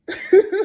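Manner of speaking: sudden loud high-pitched laugh. Real, not acted. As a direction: burst out laughing. Laughing at tortoise's claim.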